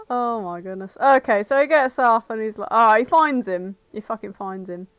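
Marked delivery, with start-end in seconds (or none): none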